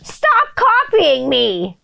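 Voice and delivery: put-on high, slow, drawn-out child whine; kid's whine. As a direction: whine it like a small kid. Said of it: "I hear one of the neighbors' kids say".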